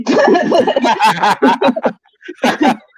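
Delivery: laughing, begging for an edit; giggling edit edit. Real, not acted. Laughing call to edit the recording.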